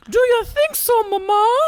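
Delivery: squeaky falsetto, naive and hopeful; a grown son sounding like a small child; mock falsetto asking his mother hopefully; high-pitched voice. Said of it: "And he's like".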